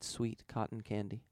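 ordinary delivery

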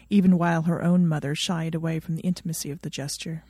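usual speaking voice

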